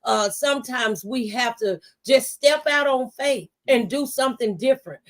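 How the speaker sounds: ordinary delivery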